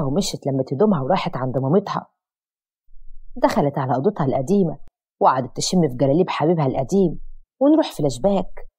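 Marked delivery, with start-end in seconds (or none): none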